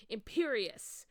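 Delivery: loud, high spell cast; loud, high shouted curse. A narrator's reading. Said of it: "Voldemort shouted".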